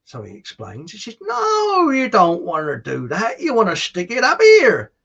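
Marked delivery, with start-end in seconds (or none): 1.2-4.9 high, loud, hammed-up screech; dairymaid. Dairymaid correcting the farm boy, in a high mock holler. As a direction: swoop up high and holler it as a joke woman. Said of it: "the dairymaid walks in".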